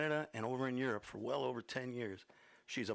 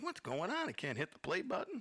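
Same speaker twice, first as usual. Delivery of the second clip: much higher, frantic pitch jump; mock panic about the play button; 6 semitones higher than usual. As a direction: play up his own jitters. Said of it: "I'm like".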